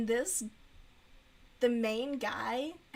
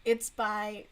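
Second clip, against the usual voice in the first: ordinary talk, nothing marked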